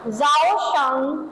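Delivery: जाओ शंग stretched very slowly, जाओ stressed with a rise; slow pronunciation model. Shown as a demonstration. Teacher models जाओ शंग slowly so students can repeat. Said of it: "क्या पढ़ेंगे?".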